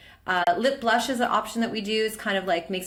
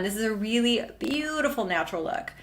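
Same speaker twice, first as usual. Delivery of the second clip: ordinary talk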